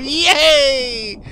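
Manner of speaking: usual speaking voice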